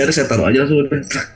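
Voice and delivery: plain reading voice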